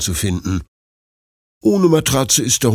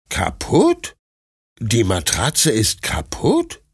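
puzzled puppy Bruno; 2 semitones higher, slower than usual. A narrator's reading. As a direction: ask in disbelief like a confused puppy. Narrator voices puppy Bruno. (verwunderter kleiner Welpe, hohe Stimme)

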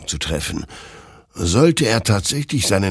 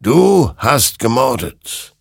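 slow, loud, raised condemnation; stern accusation; 6 semitones higher, 5 dB louder, slower than usual. A narrator's reading. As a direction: pronounce the verdict with heavy force. Creature accuses Hector of murder. (langsam, laut und anklagend)

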